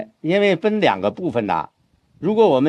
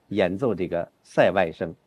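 ordinary delivery

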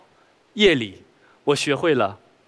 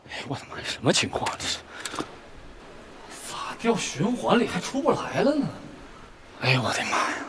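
hushed, breathy whisper; whispering to himself; 8 dB quieter than usual. Acted. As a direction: whisper in dismay to yourself. Muttering alone after fleeing.

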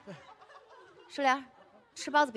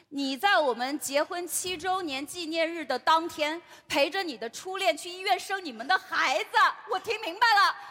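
building accusation; 3 semitones higher than usual. Acted. Wife exposes husband's first-love affair, building accusation. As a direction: lay out the charge, rising to outrage.

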